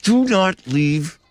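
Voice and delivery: slow gritted-teeth parent order; clenched-jaw parent order; through gritted teeth. Acted. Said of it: "parent talk through your teeth thing".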